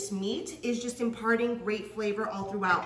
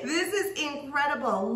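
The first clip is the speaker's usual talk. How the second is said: laughing delight through words; 3 semitones higher than usual. Real, not acted. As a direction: laugh while you say it. Overjoyed at the sandwich.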